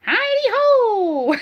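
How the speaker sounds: high, slow, laughing Mr Hankey. Retold or imitated. Doing Mr Hankey for her thumb puppet, high and cheery. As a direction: sing out his cheery catchphrase. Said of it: "Mister Hanky the Christmas Poo".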